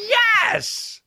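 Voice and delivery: very high, sudden yelp of triumph; triumphant yelp. Real, not acted. Host finding his notes, squealing yes. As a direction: squeal out a victory cry.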